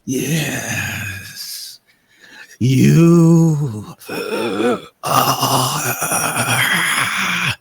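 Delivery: very slow, breath-heavy rasp; menacing coffin creature. Acted. Coffin figure naming the intruders its enemies, slow whispery menace. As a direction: leave long gaps, rasping out each word.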